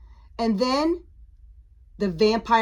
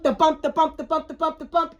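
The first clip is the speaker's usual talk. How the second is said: higher, even staccato bounces; rhythmic storybook sound effect; bouncing rhythm; 5 semitones higher, faster than usual. A narrator's reading. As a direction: bounce each word along downhill. Pumpkin bouncing down the hill.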